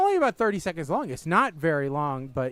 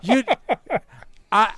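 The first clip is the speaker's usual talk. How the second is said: normal talking voice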